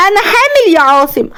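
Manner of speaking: high-pitched hysterical taunt; deranged villainess cry; crazed. A narrator's reading. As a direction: announce it high and unhinged, fresh from mad laughter. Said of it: "وهي بتضحك بجنون".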